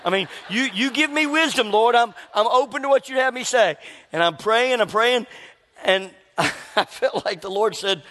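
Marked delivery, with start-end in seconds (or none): 0.0-3.8 higher, earnest mock plea; pleading prayer. Angry man praying to the Lord for wisdom, pleading. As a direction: plead upward for guidance.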